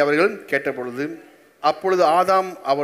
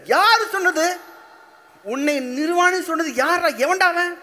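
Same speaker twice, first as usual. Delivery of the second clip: high, insistent angry questioning; acting out God's outrage, pitched far up; indignant demand; 13 semitones higher than usual. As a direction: demand the culprit's name in a raised voice. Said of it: "அப்படிங்கிறாரு பாருங்க".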